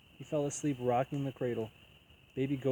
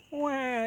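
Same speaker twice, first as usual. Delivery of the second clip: put-on high, wailing baby cry; shrill infant "wah"; 12 semitones higher than usual. A narrator's reading. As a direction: wail like a hungry newborn. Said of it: "yelled the baby ghost".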